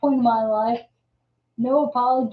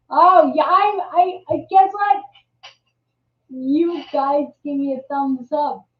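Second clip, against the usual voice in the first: excited; 4 semitones higher, 5 dB louder than usual. Real, not acted. Excited about viewer thumbs up.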